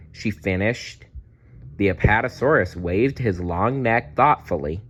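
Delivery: ordinary delivery, nothing marked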